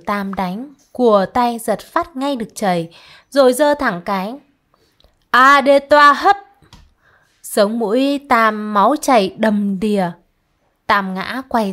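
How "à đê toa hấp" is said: loud, slow, higher battle yell; furious shout. Acted. Ván Cách swings the pestle at Tam, furious slow shout. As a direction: bellow it as you swing.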